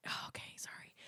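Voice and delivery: breathy unvoiced whisper. Real, not acted. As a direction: drop to a whisper. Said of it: "I always wanna whisper".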